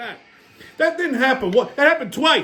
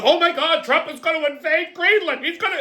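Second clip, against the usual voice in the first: higher, mock-hysterical media voice; panicked media; 8 semitones higher than usual. Comic mocking the media, higher mock panic. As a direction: squeal it like a panicking newscaster. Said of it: "so the media goes".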